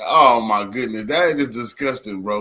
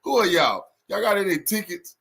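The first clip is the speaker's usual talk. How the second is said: higher, pushy mock cop questioning; mock police officer; 6 semitones higher than usual. Mocking police questioning people at a photo op, higher and pushy. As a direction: bark at them like a cop checking IDs. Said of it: "The police walk over there".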